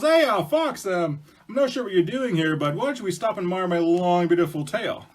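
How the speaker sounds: loud, high hail, then showy bragging; boastful bear. Acted. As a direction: hail Fox and fish for admiration. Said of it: "And he goes".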